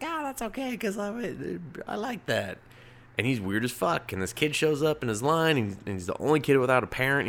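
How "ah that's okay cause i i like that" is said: lightly voiced, high and soft, cheerfully accepting; a high, soft, easygoing townsfolk voice; high, soft mock voice of the townsfolk shrugging off the oddity. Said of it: "people are just like".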